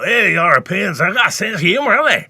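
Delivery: loud, high-pitched thick Glasgow Scots; thick Glaswegian accent. Shown as a demonstration. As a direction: imitate a broad Glaswegian, loud and rising high. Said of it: "listen to a thick Glaswegian accent".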